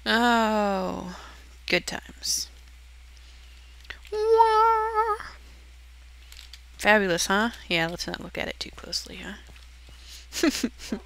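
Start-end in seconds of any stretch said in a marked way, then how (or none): 4.1-5.2 very high squeaky wah; a tiny high cartoon squeak. Silly exclamation.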